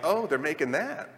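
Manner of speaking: ordinary talk, nothing marked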